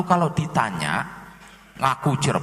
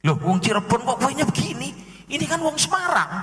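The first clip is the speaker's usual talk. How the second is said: much higher, quick disbelief; mock disbelief; 10 semitones higher, faster than usual. A Cirebon local exposing the fake, high mock disbelief. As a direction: catch him out with a high disbelieving call.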